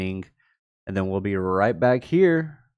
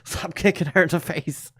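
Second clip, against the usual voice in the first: higher, urgent mock plea through a laugh; retold alarm; 8 semitones higher than usual. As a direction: stop the kid, half laughing. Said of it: "I was just like".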